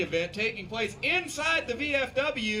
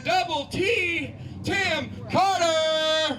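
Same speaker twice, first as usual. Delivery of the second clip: drawn-out hype-man shout, slow; announcer shout; 9 semitones higher, 6 dB louder, much slower than usual. Real, not acted. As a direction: stretch each name out like a ring intro. Hyping up the guest announcer.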